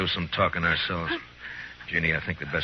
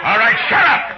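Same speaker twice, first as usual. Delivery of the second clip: much louder, higher barked command; furious shout; 10 dB louder than usual. Acted. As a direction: cut the crowd off dead. Matt silences the crowd.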